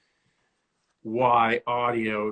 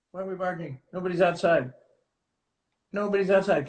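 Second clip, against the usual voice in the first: high-pitched reassurance for a pet; talking to a dog; 7 semitones higher, faster than usual. Real, not acted. Host telling the dog there is no threat, coaxing. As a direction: coax the barking dog in a higher voice.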